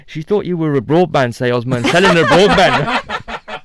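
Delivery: slow words through bursts of laughter; dad on the phone to his son, slow and laughing. Retold or imitated. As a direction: retell it while cracking up. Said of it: "my dad rang me back in fits of laughter".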